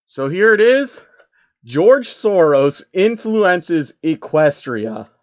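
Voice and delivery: slow, weighty title announcement; slow mock-epic title read; dramatic title read. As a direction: announce the title grandly and slowly.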